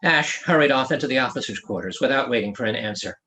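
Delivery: ordinary delivery, nothing marked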